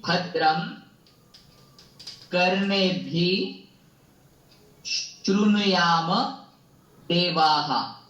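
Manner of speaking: slow, clear line recitation; plain slow pronunciation. Shown as a demonstration. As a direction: teach the line slowly, word by word. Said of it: "एक बार plane pronunciation करता हूँ". (धीमा plane उच्चारण)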